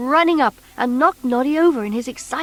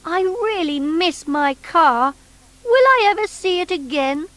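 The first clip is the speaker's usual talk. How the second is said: sadly; 7 semitones higher than usual. A narrator's reading. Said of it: "Noddy wondered sadly".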